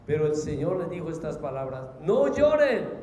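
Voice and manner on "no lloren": loud, high command to mourners; raised voice, urgent and authoritative; commanding call. Acted. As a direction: call out firmly over the weeping.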